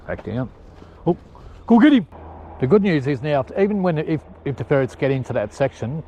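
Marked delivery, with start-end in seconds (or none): 1.1-2.0 high, loud cry to the dogs; urging shout. Real, not acted. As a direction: yell them on after the rabbit. Urging the dogs after a rabbit.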